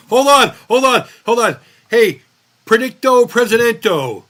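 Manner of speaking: loud, high mock summons; calling out. Host putting a question to Predicto Presidento, calling out. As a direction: holler it like a showman consulting an oracle.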